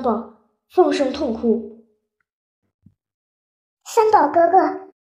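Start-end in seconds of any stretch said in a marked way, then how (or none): 3.9-4.9 high, slow, thin kitten voice; a weak little kitten calling out slowly. Acted. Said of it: "那么尖细的声音".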